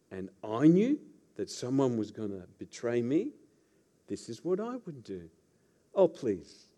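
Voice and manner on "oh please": a hint of fake sweetness, softly; insincere smile. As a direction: put on a mildly insincere smile. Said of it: "Pappy calls that the insincere smile".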